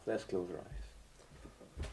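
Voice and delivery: slow, soft whisper; hushed prayer call. Acted. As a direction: invite them gently into prayer. Invitation to prayer.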